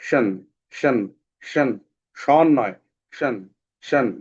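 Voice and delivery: শন repeated crisply as the tion sound; sound drill. Shown as a demonstration. Teacher drilling the শন sound for students. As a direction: say শন again and again as a drill. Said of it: "এটা থাকলে পড়তে হয়".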